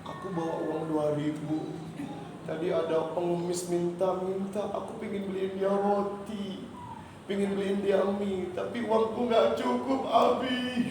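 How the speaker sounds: put-on child explaining tears to Dad; imitated tearful child. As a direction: voice a small upset child, pitch climbing toward the end. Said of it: "kenapa kamu nangis". (menirukan anak kecil yang menangis)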